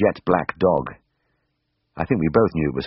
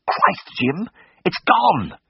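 high, shocked gasp of horror; 14 semitones higher, much slower than usual. A narrator's reading. Tristan gasping to Jim in horror, a high shocked cry. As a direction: blurt it out, stunned by the empty basket. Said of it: "he gasped".

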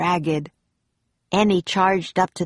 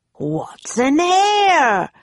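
drawn-out, high pirate bellow; demanding pirate captain; 10 semitones higher, 4 dB louder, much slower than usual. A narrator's reading. Captain Bones searches Jack's backpack, demanding loudly. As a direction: bellow the question very slowly, swooping up.

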